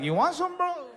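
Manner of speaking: very high, squeaky challenge; cocky taunt. Retold or imitated. Injured fighter taunts his opponent in a mock challenge. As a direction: goad him on though your hand is ruined.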